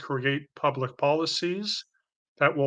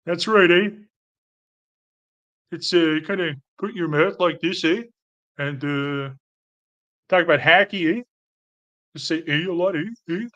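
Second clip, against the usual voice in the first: louder, hammed-up Canadian with tacked-on "eh"; exaggerated Canadian accent; 3 semitones higher, 4 dB louder than usual. Shown as a demonstration. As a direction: ham up a Canadian accent for laughs. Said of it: "trying to do a Canadian accent".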